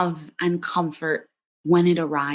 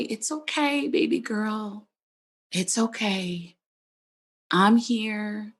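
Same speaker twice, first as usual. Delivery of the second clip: put-on cradle her like a frightened child; tender comfort. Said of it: "but with love and comfort".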